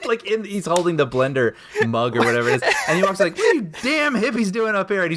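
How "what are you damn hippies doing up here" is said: higher-pitched imitation of a drunken rant; mock-indignant drunk, retold through amusement; drunk angry actor. Podcaster retells drunk actor confronting hippies in the drive, mock indignation. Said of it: "you've got a very, very drunk".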